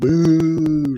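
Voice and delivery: long, high drawn-out boo; mock crowd boo. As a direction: imitate a wrestling crowd jeering. Imitates a booing wrestling crowd.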